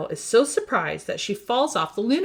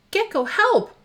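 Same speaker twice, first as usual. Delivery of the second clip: high desperate call; high shouted cry for help; 12 semitones higher, 4 dB louder than usual. Acted. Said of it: "cries Catboy".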